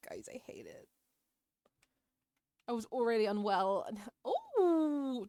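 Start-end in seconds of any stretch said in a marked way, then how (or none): 0.1-0.9 shivering, unvoiced, cold; teeth chattering. Real, not acted. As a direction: speak through chattering teeth. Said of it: "Oh my god, it's so cold!".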